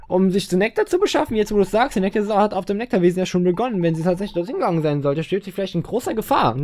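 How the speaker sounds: high-pitched put-on explaining voice; concerned game character. Character explaining where the child may be, high and concerned.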